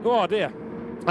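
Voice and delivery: strained, hoarse after shouting. Real, not acted. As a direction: voice going from commentary. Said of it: "I'm already losing my voice".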